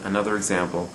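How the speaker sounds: ordinary talk, nothing marked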